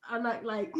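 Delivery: usual speaking voice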